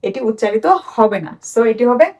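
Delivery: usual speaking voice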